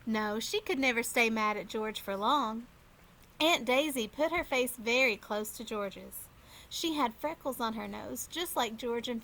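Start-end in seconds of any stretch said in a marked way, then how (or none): none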